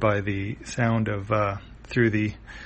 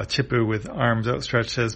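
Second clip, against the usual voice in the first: ordinary delivery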